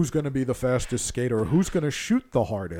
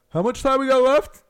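much higher, louder mock player voice; clueless player; 12 semitones higher, 9 dB louder than usual. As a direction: play the kid who wasn't listening. Coach mimics a clueless player.

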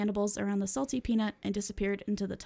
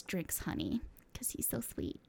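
whispered; 10 dB quieter than usual. Real, not acted. Gushing aside about favorite AU.

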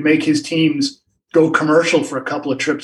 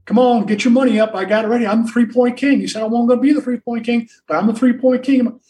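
cocky boasting; 7 semitones higher, faster than usual. Retold or imitated. Larry demanding his bet money.

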